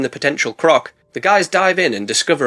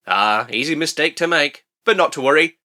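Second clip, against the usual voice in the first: ordinary talk, nothing marked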